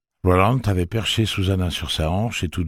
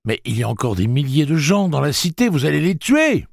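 higher, louder pleading protest; outraged protest; 12 semitones higher, 7 dB louder than usual. Acted. Eddie protests to Blaine: loud higher outrage. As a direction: plead against the killing, rising.

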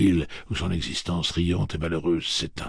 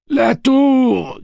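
much higher, loud shout; 14 dB louder than usual. Acted. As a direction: cry it out to the charge. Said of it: "crie Cuthbert à ses côtés".

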